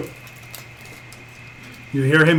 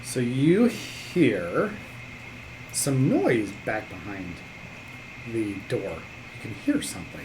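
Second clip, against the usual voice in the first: softer, slow, hushed game master; suspenseful narration; 6 semitones lower, 13 dB quieter, slower than usual. Acted. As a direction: draw them in, slowly and quietly. GM builds suspense at door.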